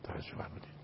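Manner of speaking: a confiding, voiceless aside; quiet whispered check-in. Real, not acted. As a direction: whisper it to the host. Aside to the host.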